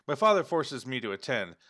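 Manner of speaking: ordinary talk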